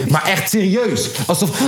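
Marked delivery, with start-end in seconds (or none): 1.3-1.6 high mock sobbing; hammed-up imitation of a crying woman; imitated crying. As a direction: break into put-on wailing. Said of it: "gaat ze huilen".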